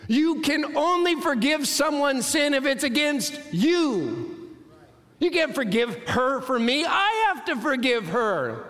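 high-pitched, slow, drawn-out protest; indignant six-year-old. Retold or imitated. Punched boy objecting to his brother, high indignant protest. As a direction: play the wronged brother, pitched high and emphatic. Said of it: "He's gonna say".

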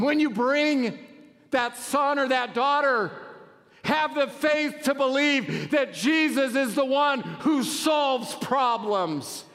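high, loud, slow preaching shout over music; preaching at full force. Acted. Preacher urging parents at full force. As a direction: lift the whole room with a rising sermon cry.

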